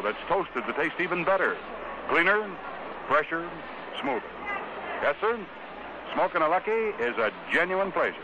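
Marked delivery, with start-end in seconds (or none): none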